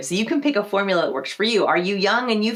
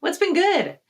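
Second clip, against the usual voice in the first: higher, chipper question; lively friendly what's good; bright upbeat greeting; 11 semitones higher, slower than usual. Shown as a demonstration. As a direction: toss it out cheerfully.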